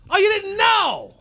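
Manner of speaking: high, loud, drawn-out mock disbelief; stretched-out, swooping rhetorical jab; taunting shout. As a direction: holler it slowly like a taunt. Taunting the audience in rant.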